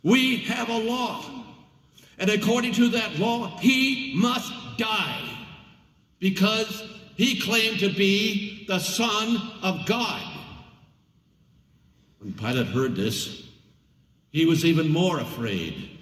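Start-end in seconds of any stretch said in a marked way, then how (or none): 0.0-10.3 high, slow insistent outcry; insisting. A narrator's reading. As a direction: demand his death, rising to a shout.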